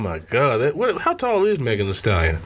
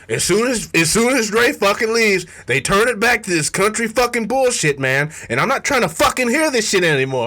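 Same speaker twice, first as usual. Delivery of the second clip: coworker ranting; 8 semitones higher than usual. Retold or imitated. Retelling the coworker's rant about country music, higher and heated. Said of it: "he was like".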